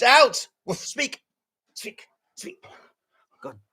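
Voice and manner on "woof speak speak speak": dog command. Acted.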